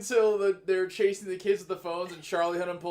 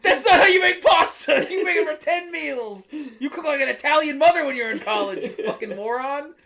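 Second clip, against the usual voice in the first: higher, louder exasperated shout; retold angry yell; 8 semitones higher, 10 dB louder than usual. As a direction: yell at the roommate in disbelief. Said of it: "I was like".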